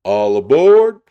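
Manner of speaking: a big, high, loud conductor call; shouted. A narrator's reading. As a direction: belt it across the whole platform. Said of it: "the conductor shouted".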